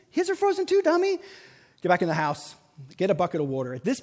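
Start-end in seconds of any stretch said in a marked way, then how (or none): 0.1-1.2 self-mocking.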